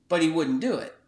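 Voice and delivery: usual speaking voice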